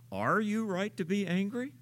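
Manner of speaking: "Are" lifted high at the start, questioning; stress on ARE. Shown as a demonstration. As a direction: lean on "Are" as a challenge, rising to the end. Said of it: "Where should I put the accent".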